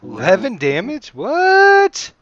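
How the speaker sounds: high, rising disbelief on "what"; loud, incredulous reaction to the roll; astonished. Real, not acted. Game master reacts to a huge damage roll, astonished.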